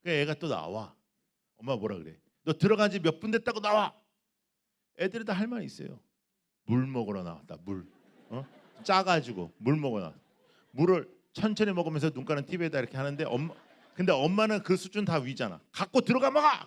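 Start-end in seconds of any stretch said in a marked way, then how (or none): 2.4-4.0 higher, louder mother's scold; a mother yelling at her son who left his room. Retold or imitated. 15.7-16.7 much higher, louder yell; a fed-up mother yelling at her son to take the water inside; mother's shout. Retold or imitated.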